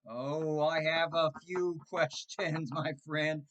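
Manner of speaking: laughing gloat. Retold or imitated. Answering the salesman with a slow gloating laugh. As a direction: savor it with a chuckle. Relishing the scammer's question.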